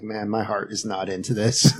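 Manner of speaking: deflated and quiet, played for a laugh; unenthused. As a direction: say it quietly, heart not in it. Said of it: "I was not enthused".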